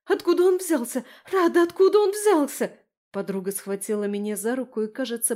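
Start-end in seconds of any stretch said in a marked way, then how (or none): 0.1-2.8 high, loud frantic questioning; panicked demand. Acted. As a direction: demand answers in a rising panic. Friend panics after the car crash.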